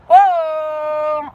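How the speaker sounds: a burst of loud surprise; blurted out loud, too much for headphones; sudden loud exclaim. Real, not acted. Streamer suddenly exclaims loudly at the chat. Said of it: "some people are on headphones".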